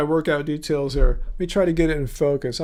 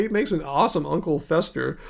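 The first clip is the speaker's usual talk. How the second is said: ordinary delivery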